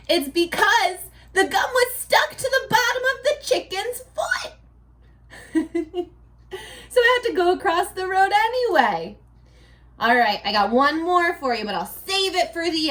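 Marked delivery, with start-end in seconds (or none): 0.1-9.2 high, giddy punchline with laughter; teacher laughing through her own joke; giggly punchline. Shown as a demonstration. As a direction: deliver it cracking yourself up.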